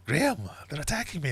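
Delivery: high, alarmed cry for help; panicked mum. Retold or imitated. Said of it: "my mum getting attacked".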